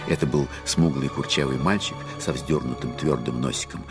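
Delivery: normal talking voice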